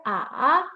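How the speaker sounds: A shaped like a V, down then up; dipping third tone. Shown as a demonstration. Teacher asking "you hear that", A in a dip-and-rise. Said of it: "a V".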